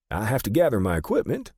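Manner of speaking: high-pitched little photographer voice; brisk small man. A narrator's reading. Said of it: "Fisher said".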